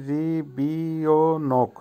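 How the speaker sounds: রিবিওনোক drawn out slowly, syllable by syllable; slow pronunciation demo. Shown as a demonstration. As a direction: stretch রিবিওনোক into ri, bi, o, nok. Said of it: "উচ্চারণ হবে". (রিবিওনোক ধীরে ধীরে, ভেঙে ভেঙে উচ্চারণ)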